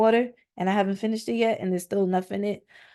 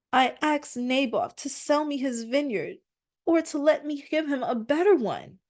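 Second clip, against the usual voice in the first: higher, whining king; whiny Ahab; 6 semitones higher than usual. Acted. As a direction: sulk it out like a spoiled king. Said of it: "He probably didn't say it like that".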